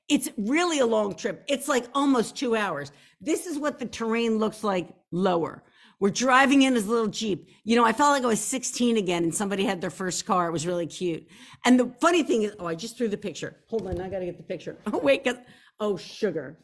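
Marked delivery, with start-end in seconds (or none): none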